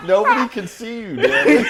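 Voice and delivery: loud, high laughing tease; helpless high-pitched laughing outburst; laughing hard. Real, not acted. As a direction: shout it through big laughter. Teasing co-host amid loud laughter.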